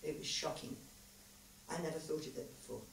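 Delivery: soft, hushed reply; quiet and shaken, drifting to a whisper; quietly. A narrator's reading. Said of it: "she said quietly".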